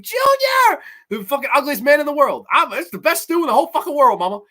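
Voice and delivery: loud, high-pitched hollering character voice; fast, high, over-the-top yelled impression; Junior. Fan yelling out a film character's lines, loud high impression. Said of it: "I love seeing Ron Sloan as Junior".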